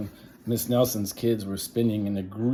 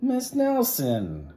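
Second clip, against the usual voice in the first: principal's voice; 14 semitones higher, slower than usual. Acted. Principal addresses her.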